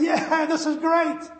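high, loud, slow delighted shout; gleeful. Retold or imitated. Boy loving the classroom's laughter, gleeful shout. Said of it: "And I'm going".

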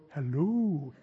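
low, soft, creepy greeting; sneaky soft hello. Acted. Lurker in the dark corner greeting a passer-by, quiet and sneaky. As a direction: say it softly from the shadows. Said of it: "don't even make a big noise".

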